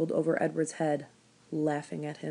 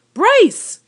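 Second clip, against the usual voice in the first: very loud, high yell of his name; old woman shouting for the boy; 16 dB louder than usual. Acted. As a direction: holler across the field. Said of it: "shouted the old woman".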